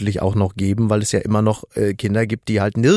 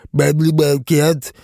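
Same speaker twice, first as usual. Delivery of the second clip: Helmut Kohl voice. Pretend. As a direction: drag it out like a contented old chancellor. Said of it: "Ich kann das nicht so gut nachmachen".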